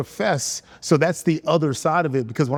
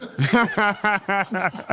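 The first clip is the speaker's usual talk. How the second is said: real laugh; 5 semitones higher than usual. Real, not acted. Laughing at joke.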